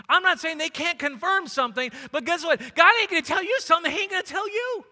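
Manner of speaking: loud, high, emphatic sermon delivery; full-force preaching. Real, not acted. Preacher telling church God will speak to them, full-force preaching.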